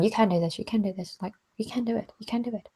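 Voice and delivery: soft, nagging whisper of doubt; gremlin whisper. Acted. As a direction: hiss it like a gremlin chewing at your head. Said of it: "the gremlins are going".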